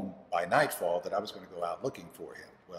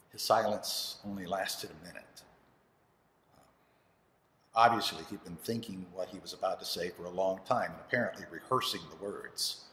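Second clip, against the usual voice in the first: ordinary talk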